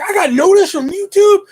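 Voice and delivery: high, loud, giddy mock squeal; excited disbelief. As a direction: relive the thrill of being recognized. Thrilled at being recognized.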